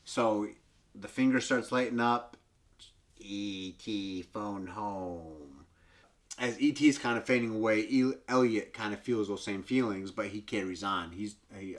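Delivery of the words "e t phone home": low, soft croaking alien voice. Retold or imitated. ET croaking to Gertie, low soft alien croak.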